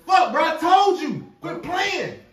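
high, loud furious yelling; angry yelling. Acted. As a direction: scream at him for the money. Friend yelling about lost money.

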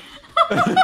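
high shrieking laugh. Real, not acted. Cracks up at the joke.

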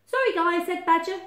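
high, loud mock apology; unbothered bossy badger; dismissive Badger. Badger dismisses Bear and Moose.

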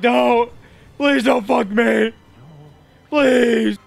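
high, loud put-on begging; hammed-up plea in a put-on voice; pleading mock voice. Gamer voicing the boss begging for mercy, mock pleading.

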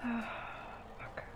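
sigh, then a whispered fuck; whispered curse. Real, not acted. Frustrated gamer whispering to herself. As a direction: breathe it out under your breath. Frustrated under breath while playing.

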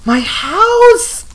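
high, very loud screams of despair; horrified screaming. Real, not acted. Gamer watching the ogre burn her house, horrified screaming. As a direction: wail over the burning house.

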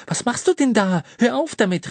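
put-on high, loud outraged girl; indignant call. A narrator's reading. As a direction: snap at him to stop. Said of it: "rief sie".

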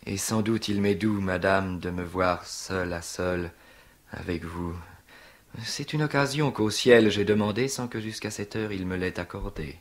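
stage seducer with lingering pauses; Tartuffe. Acted. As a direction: play the unctuous suitor, drawing out the words. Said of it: "je rêve de jouer Tartuffe".

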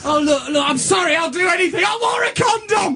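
high, hammed-up desperate apology; frantic pleading. Real, not acted. [name] begs forgiveness on Andrew's answer phone, frantic pleading. Pleading on message after spoiling it.

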